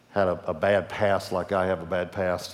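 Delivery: usual speaking voice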